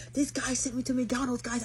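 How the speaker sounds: high-pitched outburst to the viewers; worked-up retelling. Retold or imitated. Woman re-enacting her live stream to followers, worked-up. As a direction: re-enact an indignant announcement, high.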